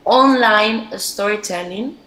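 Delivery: ordinary talk, nothing marked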